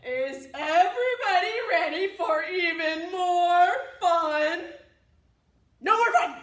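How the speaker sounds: put-on rally the kids; excited call. Cows call, chicken shouts back.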